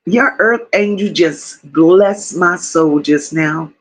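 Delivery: low, slow and awed; hushed, low-voiced gratitude; deeply moved. Real, not acted. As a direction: let the blessing sink in slowly. Moved by a chatter's blessing.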